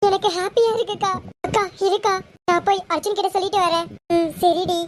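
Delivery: ordinary delivery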